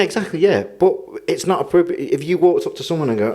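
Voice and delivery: ordinary talk, nothing marked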